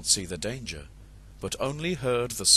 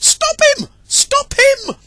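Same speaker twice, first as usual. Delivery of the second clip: very high, loud cries; high frantic yells; desperate shout; 16 dB louder, slower than usual. Acted. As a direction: chase after the fox, yelling. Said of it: "she shouted".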